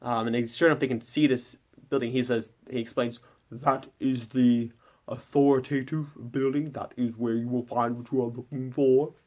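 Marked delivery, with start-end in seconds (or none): 3.5-9.1 lightly muffled fish-captain voice, as if something is in the mouth; a hint of a strange sea captain welcoming guests; Gwillock's odd voice. Fish captain welcoming the stranded party aboard, lightly muffled voice. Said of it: "And he just talks like this".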